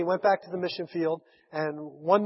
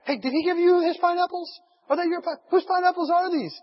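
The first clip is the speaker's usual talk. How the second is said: excited natives; 13 semitones higher, 4 dB louder than usual. Retold or imitated. Natives eagerly asking about the pineapples.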